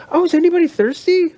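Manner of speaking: high, fast sing-song offer; chirpy lady. Retold or imitated. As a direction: mimic a too-eager hostess. Voicing the chatty closing lady.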